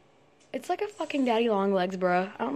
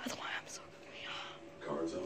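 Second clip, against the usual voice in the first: giggly hushed whisper; 17 dB quieter, slower than usual. Real, not acted. Girl mocking herself in a whisper. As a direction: breathe it as a private joke.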